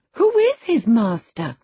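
put-on high, loud, insistent girl; tiresome girl. A narrator's reading. Girl appealing to her nurse, insistent high voice. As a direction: pester in a high girlish voice. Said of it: "continued the tiresome girl".

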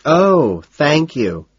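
high, gushing thanks; flattered dog owner. A narrator's reading. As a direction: gush with delight at the compliment. Flattered owner answering a compliment.

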